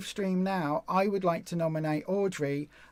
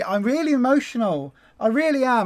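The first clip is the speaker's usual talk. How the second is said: higher and louder, voice welling up with feeling; really emotional; 5 semitones higher, 7 dB louder than usual. Real, not acted. Host moved by a viewer's confession, higher and louder with emotion. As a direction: let the emotion swell up, near tears. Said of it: "I'm feeling so emotional right now".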